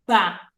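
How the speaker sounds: ba with the mouth opened wide; ba com a boca bem aberta. Shown as a demonstration. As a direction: drop the jaw wide on ba. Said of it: "daquela que abre bem a boca".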